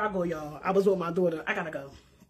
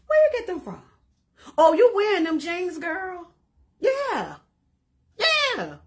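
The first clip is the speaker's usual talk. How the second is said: higher, lightly voiced gushing compliments; mock admiring onlookers; 5 semitones higher than usual. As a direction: play the impressed girls who spot the jeans. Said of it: "This is what they gonna say".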